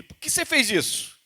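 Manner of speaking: a father asking his son why; father questioning son. Retold or imitated. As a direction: ask like a disappointed father. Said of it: "a pergunta do pai para o filho".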